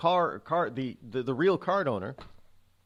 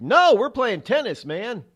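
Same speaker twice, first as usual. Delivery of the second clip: high, protesting tennis player, loud at first; mock-indignant retort, pitched up; indignant card owners; 7 semitones higher, 6 dB louder than usual. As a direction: protest in a raised voice as the accused cardholder. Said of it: "And they're like".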